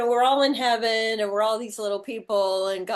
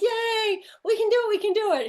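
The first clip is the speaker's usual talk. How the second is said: higher, fast cheering little people; mock cheering; 10 semitones higher than usual. Little people cheering at God's test, higher and fast. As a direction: cheer like giddy kids. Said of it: "And they're like".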